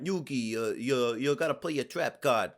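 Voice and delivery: put-on loud Italian American Joey accent; slow Italian American dub voice. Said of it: "he sounds like Italian American".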